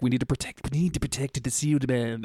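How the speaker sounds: a light, hammed-up hiss of plotting snakes. Host voicing snakes plotting to protect their master, mock hissing. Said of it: "That's what they sound like for sure".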